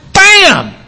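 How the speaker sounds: preacher driving home the signal word; emphatic bam. Shown as a demonstration. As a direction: hit it like a chef. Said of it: "That's a signal word".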